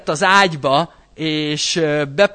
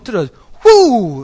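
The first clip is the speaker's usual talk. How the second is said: loud, slow mock whoosh; whoosh sound; 4 semitones higher, 8 dB louder, slower than usual. Preacher shows faith flung away, a loud whoosh.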